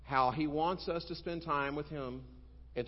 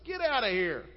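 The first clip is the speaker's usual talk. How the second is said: loud, high "get out"; judge's dismissal; 9 semitones higher, 7 dB louder than usual. Acted. Uncaring judge sends the widow away, loud and high. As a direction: bark the widow out of the room.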